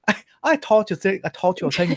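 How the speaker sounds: high, badly faked Irish accent. Shown as a demonstration. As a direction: try an Irish brogue and fumble it. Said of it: "I'm really bad at this".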